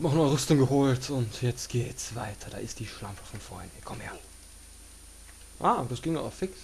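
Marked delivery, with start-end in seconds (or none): 2.5-4.1 quiet menacing. Real, not acted. Spots enemy, quiet menace.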